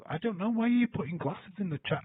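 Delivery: fed up with the chat; exasperated. Real, not acted. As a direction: throw the hands up at the chat.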